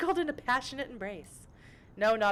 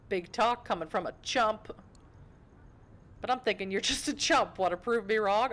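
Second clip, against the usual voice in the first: lightly voiced street thug, a little higher; Brujah tough; 4 semitones higher, 3 dB louder than usual. Hooded Brujah challenges intruder on his turf, lightly voiced tough guy. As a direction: put on a mild gang-tough threat. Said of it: "I don't know why I'm talking like this".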